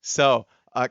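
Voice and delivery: ordinary talk, nothing marked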